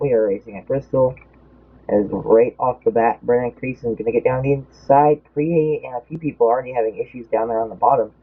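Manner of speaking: normal talking voice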